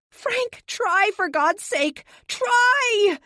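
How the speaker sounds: screaming at injured Frank. A narrator's reading. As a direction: scream at him to try. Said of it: "I scream at him".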